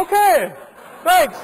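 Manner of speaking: can hardly contain the delight; barely hidden glee. Retold or imitated.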